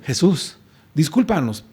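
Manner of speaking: soft, meek little apology; a timid sorry, not a cry; mild polite apology. Shown as a demonstration. Preacher shows what they did not say, meekly. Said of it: "No simplemente le dicen".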